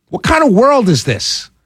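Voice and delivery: normal talking voice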